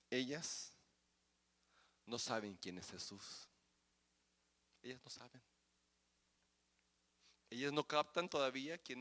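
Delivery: soft, low, intimate sermon voice; hushed preaching. Acted. Preacher quietly assuring the congregation, hushed. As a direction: drop to a hush to draw them in.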